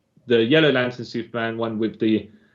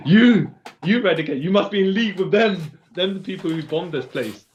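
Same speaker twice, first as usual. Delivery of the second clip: hammy villain accusation; 5 semitones higher than usual. Retold or imitated. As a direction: play it as a cartoon villain accusing. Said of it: "Cyborg Superman says".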